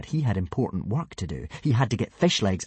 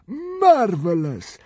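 slow high spluttering consul; 11 semitones higher, 5 dB louder, much slower than usual. A narrator's reading. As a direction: splutter it with delight. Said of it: "spluttered the fat consul".

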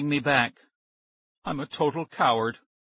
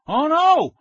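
high, loud cry of refusal; scream; 9 dB louder than usual. A narrator's reading. Maxie spinning round on two friends, screaming. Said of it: "I screamed, spinning around".

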